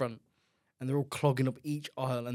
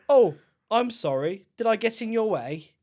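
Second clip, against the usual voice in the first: much higher and louder, slow fake sorry; loud sarcastic apology; 9 semitones higher, 9 dB louder, slower than usual. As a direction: fake politeness aimed at a blocker. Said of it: "I'll say it loud enough for them to hear".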